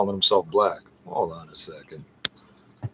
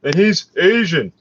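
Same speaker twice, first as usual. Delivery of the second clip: pitched up, slowed sarcastic parroting; slow high sarcastic imitation of others' claim; mocking quote; 8 semitones higher, 3 dB louder, slower than usual. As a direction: sing it out like a ridiculous claim. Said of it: "these the same idiots trying to tell me".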